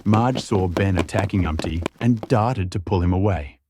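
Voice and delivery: ordinary delivery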